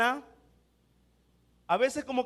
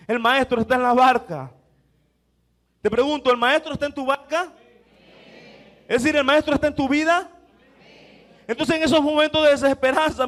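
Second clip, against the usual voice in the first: louder, driving sermon cadence; preaching at full force; 5 dB louder than usual. Acted. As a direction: push the message home. Predica con fuerza a la congregación. (predicación enérgica y en voz más fuerte)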